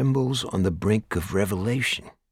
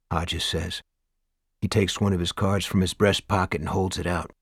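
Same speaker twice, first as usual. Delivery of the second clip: ordinary delivery, nothing marked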